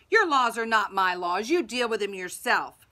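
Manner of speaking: loud, forceful retort; crying out. A narrator's reading. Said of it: "Pilate cried".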